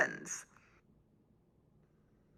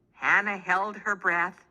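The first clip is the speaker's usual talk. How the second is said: plain reading voice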